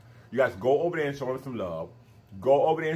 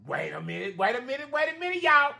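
building excitement; 10 semitones higher than usual. Real, not acted. Calls viewers back, building.

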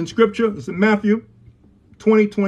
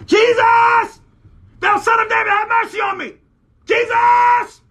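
high, desperate full-voiced shout; yelling; 13 semitones higher than usual. Blind beggar calling to Jesus for mercy, yelling. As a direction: cry out over a crowd for help. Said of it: "he was yelling".

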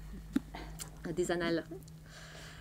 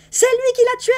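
much higher, much louder cry; triumphant shout; 13 semitones higher, 9 dB louder than usual. A narrator's reading. Granny standing up to accuse the actor, a triumphant high shout. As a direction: denounce the murderer in triumph. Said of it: "cria-t-elle d'une voix triomphante".

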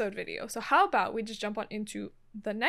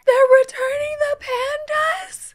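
shocked shout; 14 semitones higher, 17 dB louder, much slower than usual. Real, not acted. Shocked at panda news.

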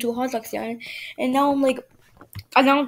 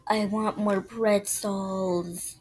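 whiny slow plea; 3 semitones lower, much slower than usual. Acted. Child begging for more pretzels.

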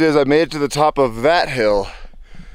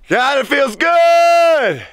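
triumphant shout; 7 dB louder, much slower than usual. Real, not acted. Reaching the summit.

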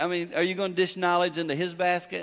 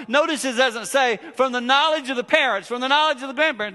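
higher and louder, pressing on knowledge; preaching emphasis; 9 semitones higher, 4 dB louder than usual. Real, not acted. Preacher telling the church where knowledge comes from, emphatic.

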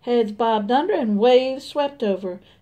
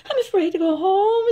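scared chicken; 8 semitones higher, faster than usual. Acted. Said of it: "said one chicken".

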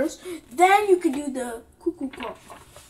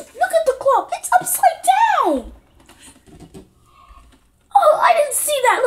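much higher, louder amazed pointing out; real excited surprise; excited discovery; 13 semitones higher, 6 dB louder than usual. Real, not acted. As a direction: exclaim at the upside-down clock. Reader excited at picture joke.

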